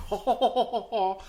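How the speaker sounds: high, loud, hammed-up ho ho laugh; mocking British laugh. British travellers mocking his accent, high laughing ho ho. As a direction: laugh it up like snooty Brits mocking you. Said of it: "And they're like".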